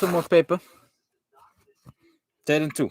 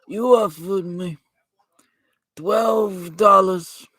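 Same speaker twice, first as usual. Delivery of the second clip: pitched-up mafia don; Vito Corleone. Acted. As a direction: hold a comic godfather impression through a rambling grievance. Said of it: "This is the Godfather from The Godfather".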